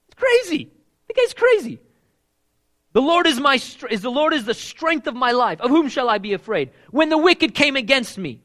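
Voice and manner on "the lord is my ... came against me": louder, higher, bold and cocky; with attitude. Retold or imitated. Preacher voicing King David's defiant psalm with attitude. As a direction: read it like a dare. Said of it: "You gotta read King David with attitude".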